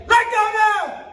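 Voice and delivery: full shouted self-defense command. Shown as a demonstration. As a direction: yell it to make them let go. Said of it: "I like to say".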